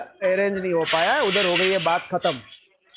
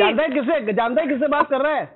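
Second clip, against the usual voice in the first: heated, higher-pitched intimidation; angry threat; 5 semitones higher than usual. Real, not acted. Debtor threatening loan collector mid-quarrel, angry. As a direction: challenge him like a real threat.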